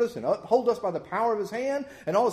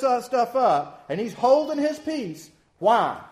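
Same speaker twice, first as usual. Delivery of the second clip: louder, rising preacher's fervor; preaching at full force; 4 semitones higher, 5 dB louder than usual. Acted. Preacher warning the congregation of God's wrath, fervent and louder. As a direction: build it up like a revival preacher.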